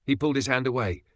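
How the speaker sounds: ordinary delivery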